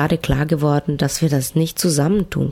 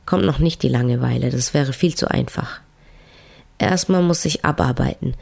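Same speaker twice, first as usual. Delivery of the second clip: usual speaking voice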